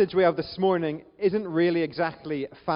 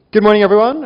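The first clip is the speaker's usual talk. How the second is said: loud, raised-pitch greeting to the room; calling out a greeting; 4 semitones higher, 15 dB louder, faster than usual. Real, not acted. Preacher greeting the congregation, calling out loudly. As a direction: project a big hello across the hall.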